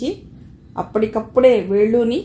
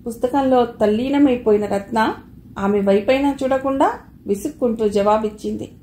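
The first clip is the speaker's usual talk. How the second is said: usual speaking voice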